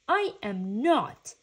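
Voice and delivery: loud, emphatic denial; loud, cranky protest; indignant shout. A narrator's reading. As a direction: shout it back crossly. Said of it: "shouted Franklin".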